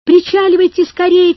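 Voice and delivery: pressing cry that builds on the repeat; shouting. A narrator's reading. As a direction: holler to the raft to come ashore. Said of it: "кричал он".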